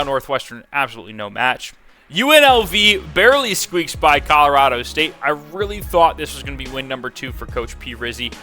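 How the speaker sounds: normal talking voice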